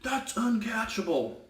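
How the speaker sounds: high, nagging coach impression; a coach pestering the ref, hammed up high; mock whiny coach. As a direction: whine it like an outraged coach. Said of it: "the example I just gave".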